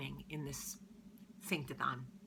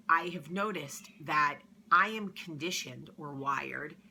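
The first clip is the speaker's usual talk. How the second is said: ordinary talk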